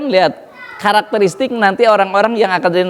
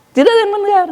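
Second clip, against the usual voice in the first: high emphatic cry; much faster than usual. Acted. Emphatic repeat.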